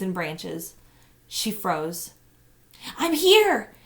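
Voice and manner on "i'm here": loud, higher call. A narrator's reading. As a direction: call back through the branches. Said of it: "he called".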